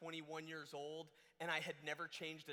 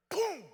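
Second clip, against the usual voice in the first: sudden high, loud imitated blast; explosive boom; 14 semitones higher, 12 dB louder than usual. Shown as a demonstration. Storyteller imitating a blowout on the highway, explosive boom. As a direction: burst out with a booming bang. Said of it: "it was louder than that".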